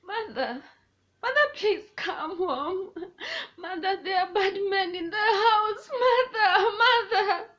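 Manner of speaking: put-on loud, high child's wail for mother; acted sobbing, rising to a scream; imitated crying daughter. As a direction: cry out like a terrified little girl. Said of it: "And she will cry".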